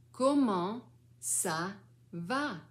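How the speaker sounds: slow, spaced-out question; slow clear model. Shown as a demonstration. Grandmother saying the child's question, slow and clear. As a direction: draw out each word for a beginner.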